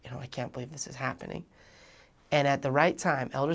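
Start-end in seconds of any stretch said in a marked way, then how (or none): 0.0-1.4 softer, hurried muttered dread; muttering to himself. Retold or imitated. Nervous missionary muttering to himself at the door, hushed. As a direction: mumble it under your breath. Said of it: "saying to myself".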